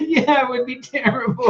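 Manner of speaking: high, laughing put-on agreement; mock agreement. Lizzie playing along with her father's joke, mock agreement. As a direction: laugh through an imitated, too-eager agreement. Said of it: "And she's like".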